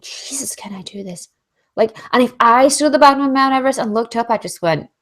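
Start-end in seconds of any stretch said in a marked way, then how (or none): 0.0-1.3 breathless whisper at the foot of the mountain; awed whisper. Retold or imitated. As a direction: whisper it, staring up at Everest. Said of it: "they probably think".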